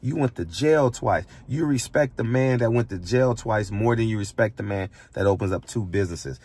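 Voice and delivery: ordinary talk, nothing marked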